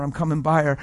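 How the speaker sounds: ordinary talk, nothing marked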